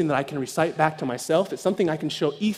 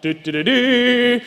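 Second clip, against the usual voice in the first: fanfare hum; 6 semitones higher, 4 dB louder, much slower than usual. Acted. He steps up.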